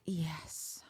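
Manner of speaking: hushed, mock-swooning yes; breathy swooning whisper. Viewer swooning over the bachelor's move, whispered. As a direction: whisper it as if overcome. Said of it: "And it's like".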